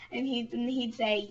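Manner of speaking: usual speaking voice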